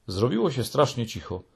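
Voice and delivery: plain reading voice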